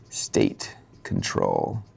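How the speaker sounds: very low, drawn-out put-on voice; dramatic deep echo. Shown as a demonstration. Speaker repeats his concept for effect, deep and slow. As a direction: stretch it out in a deep, ominous tone.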